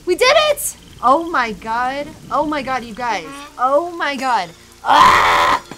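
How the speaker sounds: squealing high, loud celebration; overjoyed shrieks, can't believe it worked; giddy relief. Real, not acted. Puzzle finally solved.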